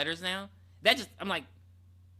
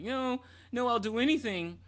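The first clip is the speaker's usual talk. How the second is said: higher-pitched retold begging; mock pleading; 6 semitones higher than usual. Caught guy begging not to be outed, mock pleading. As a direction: lift the voice into a light mock plea.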